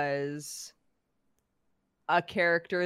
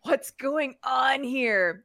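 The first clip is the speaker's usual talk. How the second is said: higher, drawn-out bewildered question; baffled reaction; 7 semitones higher, 4 dB louder, much slower than usual. Retold or imitated. Podcast host reacting to a manga twist, baffled. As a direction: stretch it out, slow and incredulous.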